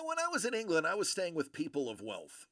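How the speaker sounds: ordinary delivery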